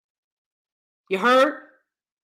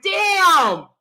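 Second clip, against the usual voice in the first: stunned; 9 semitones higher than usual. Retold or imitated. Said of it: "I was like".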